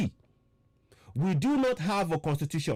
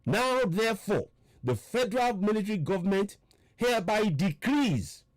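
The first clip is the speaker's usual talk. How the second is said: slow, higher-pitched emphatic reading; 4 semitones higher than usual. Real, not acted. Repeating the alarming decree words to listeners, slow and emphatic. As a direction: spell out each phrase as a warning. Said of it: "I will read it slowly".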